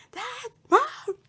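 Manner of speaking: high, loud cry for parents; mock-frantic high-pitched yell; panicked calling. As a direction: call out desperately for help, retold.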